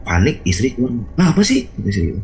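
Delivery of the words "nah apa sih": high-pitched imitated alarmed question; startled wife. Wife to husband rushing in panicked, imitated startled question. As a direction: mimic a wife caught off guard, pitched way up.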